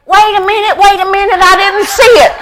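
put-on high, excited boy pleading to watch; a younger son rushing over, begging to see; eager kid. Little brother begging to watch, high and excited.

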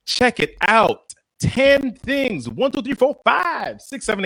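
rising, shouted countdown; hyped countdown. Pretend. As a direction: build the count up big. Hyped countdown opening the show.

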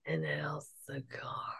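slow, strained whisper; tight, whispered question; restrained dad. Retold or imitated. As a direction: imitate a dad barely daring to ask. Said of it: "to not ask about the car".

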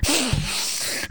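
sharp startled gasp on waking; mock waking gasp; dramatic gasp. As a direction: gasp awake like surfacing from water. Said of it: "You wake up so fucking dramatic".

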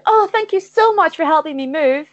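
high, gushing squirrel voice; grateful squirrel. Acted. Game master voices Susie the squirrel.

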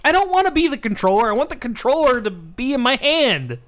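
high, exasperated comic rant; mock-outraged refusal; indignant protest. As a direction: protest like a fed-up gamer. Acting gamer refusing Kinect pitch.

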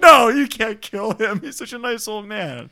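loud, pleading mock protest, slowed down; a hammed-up plea to spare him. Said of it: "And you're like".

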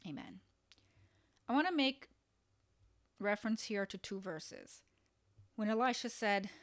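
normal talking voice

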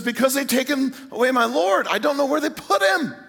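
high, distressed acted plea; distraught. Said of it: "She's just distraught".

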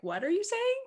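high-pitched puzzled question; high, bewildered little query; retold bafflement. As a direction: ask it baffled, sliding right up high. Retold baffled reaction to teammate.

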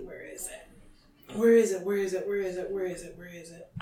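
very soft, low-energy repeated muttering; muttering to self. Real, not acted. Searching the book for the passage, muttering to self. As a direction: mumble it under your breath while searching.

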